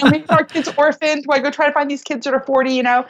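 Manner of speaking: high, fretful comic worrying; hammed-up catastrophizing; mock panic. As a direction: play the anxious wife for laughs. Acts out panic before the trip.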